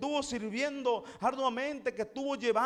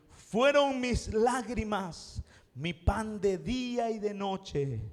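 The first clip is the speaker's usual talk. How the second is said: slow scripture reading; much slower than usual. Retold or imitated. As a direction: read the verse slowly and solemnly. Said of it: "el salmista expresa y dice".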